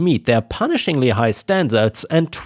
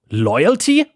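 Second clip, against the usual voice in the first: an indignant questioning retort, slightly higher; shot back; 4 semitones higher than usual. A narrator's reading. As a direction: fire the word back at the father. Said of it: "I shot back".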